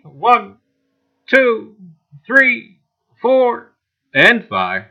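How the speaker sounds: rising, loud countdown. Real, not acted. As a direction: count up to the big moment. Countdown for the giveaway bot.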